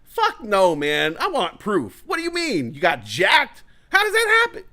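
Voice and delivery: high loud incredulous demands; outraged disbelief. Retold or imitated. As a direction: shout in shock, demanding proof. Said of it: "Nobody was like".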